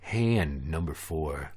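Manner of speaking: announcer voice. Acted. Low slow game announcement.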